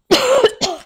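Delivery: real cough from a throat tickle; tickly throat cough. Real, not acted. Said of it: "I've got a tickle in my throat".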